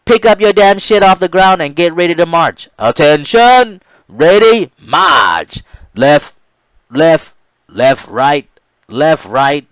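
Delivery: loud, high barked cadence; marching cadence yell. Retold or imitated. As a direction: call the march like a drill instructor. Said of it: "the third R D C yelled out".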